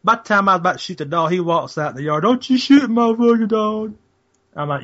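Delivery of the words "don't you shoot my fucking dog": higher-pitched, drawn-out mock neighbor; slow, raised-pitch mock plea; retold neighbor's protest. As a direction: imitate an upset dog owner calling out. Acts out neighbor protesting in yard.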